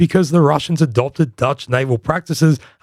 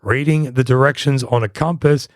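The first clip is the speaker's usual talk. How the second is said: ordinary talk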